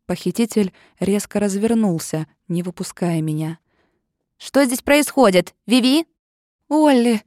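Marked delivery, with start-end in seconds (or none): 4.4-6.1 sharp and high, a loud alarmed question; alarmed call. A narrator's reading. Оливия находит подругу в плену. 6.7-7.2 high tearful call for her friend; sobbing cry. A narrator's reading. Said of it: "всхлипнула я".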